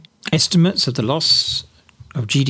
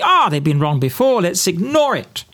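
mock dismissive sceptic; 6 semitones higher than usual. Commentator mocks Brexiteers ignoring forecasts, sneering higher voice. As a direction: sneer it like a know-all waving away warnings. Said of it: "But you say".

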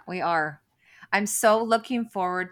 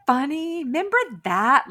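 high, loud, sing-song sarcasm; mock cheerful; 11 semitones higher, 11 dB louder than usual. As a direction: gush over it with fake nostalgia. Mocking future fond memories.

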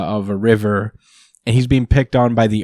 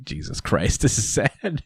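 quiet sad aside; 7 dB quieter than usual. Real, not acted. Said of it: "and I'm like".

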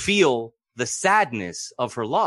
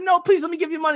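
Avram pleading; 4 dB louder, faster than usual. Retold or imitated.

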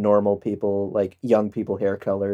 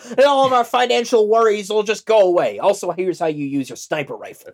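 high crank voice; 12 semitones higher than usual. Retold or imitated. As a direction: rant like a gold-bug uncle. Voicing crank sergeant.

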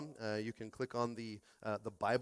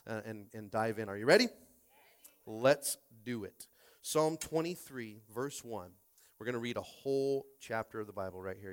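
ordinary talk, nothing marked

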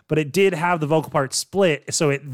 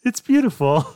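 cheesy romantic line; 8 semitones higher, 4 dB louder than usual. Pretend. Hammed-up replay of imagined cheesy line.

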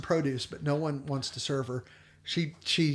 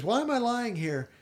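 mock surprise; 8 semitones higher, 3 dB louder than usual. Retelling Ema, suddenly well.

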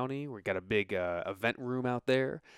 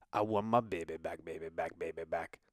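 half-chanted, hammed-up Scottish accent; mock Scottish accent; 4 semitones lower than usual. Shown as a demonstration. Host clowning in a bad accent, mock Scottish accent. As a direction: chant it in a badly faked Scottish voice, trailing lower. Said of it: "that's not really how they talk".

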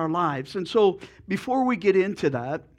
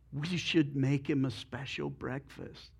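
softer, lower chicken with a plan; scheming chicken; 4 semitones lower, 10 dB quieter than usual. Acted. Chicken suggesting breakfast to the pig, soft and sly. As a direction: say it softly, like a sly idea.

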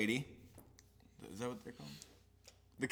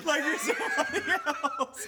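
laughing; 14 semitones higher, 4 dB louder than usual. Real, not acted. Laughing joke.

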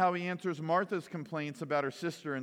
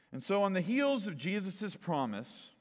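ordinary talk

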